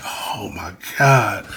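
slow, drawn-out whispered groan; hushed, appalled aside; dismayed whisper. Retold or imitated. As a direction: whisper it in mock disbelief. Own dismayed reaction, retold.

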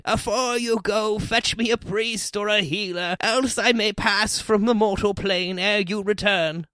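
high, slow plea from a sickbed; high, slow ailing parent; ailing mother. A narrator's reading. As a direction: play the dying mother, pitched well up and drawn out. Ailing mother pleads with son.

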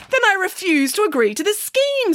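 high, emphatic defiance; indignant refusal. Acted. Nanny defies the bank manager.

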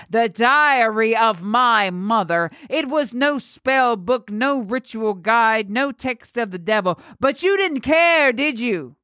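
high, mounting indignation; bitter confrontation. Acted. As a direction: climb in pitch toward the final accusing question. Girl confronts the priest she grips.

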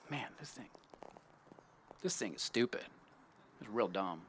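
breathy whispered aside, off-mic; muttered aside. Real, not acted. Speaker grumbles aside about the thing on his face, whispered. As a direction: drop under the breath, annoyed at the gear.

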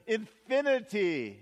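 lightly voiced child, drawn out; child's answer. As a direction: say it like a proud kid. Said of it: "somebody says".

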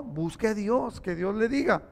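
ordinary talk

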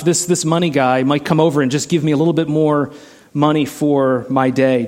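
ordinary delivery

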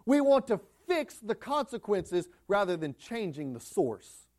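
normal talking voice

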